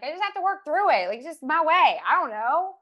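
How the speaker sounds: high, loud protesting; retold defensive insistence. Emotional woman answers people asking why she cries, defensive. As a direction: push back at whoever questions the tears.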